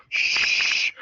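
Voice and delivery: mock hushing noise; sharp, voiceless shush as the classmate; imitated shush. Mischievous kid on a desk to classmate, shushing.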